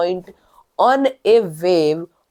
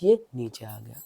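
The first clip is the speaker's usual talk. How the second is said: much softer and lower; quieter; 10 semitones lower, 9 dB quieter than usual. Shown as a demonstration. Teacher showing the class the wave falling, softly. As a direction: sink the voice right down. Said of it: "अब मैं धीरे जा रहा हूं".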